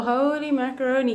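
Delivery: normal talking voice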